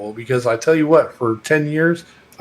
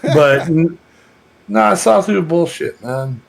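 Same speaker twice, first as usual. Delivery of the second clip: Texas twang; 4 semitones higher, 4 dB louder than usual. Shown as a demonstration. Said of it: "My little Texas accent".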